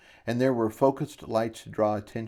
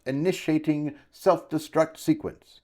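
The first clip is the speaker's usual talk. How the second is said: higher, emphatic official announcement; announcing; 6 semitones higher than usual. A narrator's reading. Shopkeeper starting the destruct sequence, announcing emphatically. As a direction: proclaim it word by word. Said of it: "he announced".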